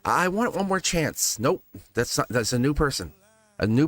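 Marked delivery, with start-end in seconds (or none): 0.0-1.4 higher, drawn-out mock plea; mock pleading. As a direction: plead slowly for another chance, half in jest. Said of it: "you're trying to justify".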